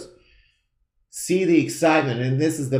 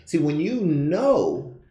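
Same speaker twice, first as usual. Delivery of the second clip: ordinary talk, nothing marked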